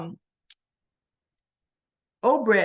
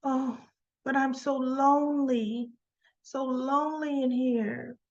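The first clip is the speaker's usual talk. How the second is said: soft, higher, drawn-out mock lonesome voice; lonely plea; 4 semitones higher, 4 dB quieter than usual. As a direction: plead softly, acting out loneliness. Prisoner pleading for company with guard.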